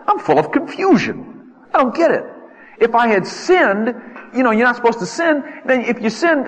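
high, strained bewildered complaint; anguished confusion. Acted. Preacher voicing Job, anguished and high-pitched. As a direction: cry out why, voice pitched high.

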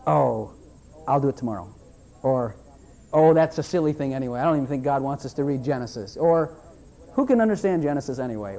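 low, offhand whiny excuses; mock lazy excuses. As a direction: shrug it off like a lazy believer. Mocking excuses of lazy readers.

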